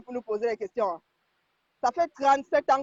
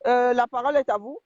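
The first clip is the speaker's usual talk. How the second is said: ordinary talk, nothing marked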